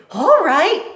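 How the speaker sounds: high, loud compliance; Mig agreeing. Acted. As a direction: chirp it obediently. Mig obeys.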